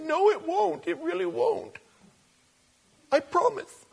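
put-on high, soft childish insisting; mock pleading. Mimicking people pleading with God.

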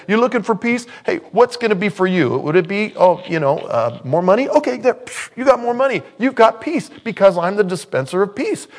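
high, loud salesman-like offer; hammed-up preacher handing out wishes; mock peace dispenser. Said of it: "I'm not the guy that says".

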